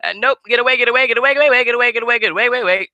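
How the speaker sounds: high, loud panicked chant of get away; frantic singsong yell. Retold or imitated. Wrestler fleeing a chasing rival, high frantic singsong yell. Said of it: "make it into a tone like I just did".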